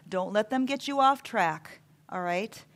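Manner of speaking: ordinary delivery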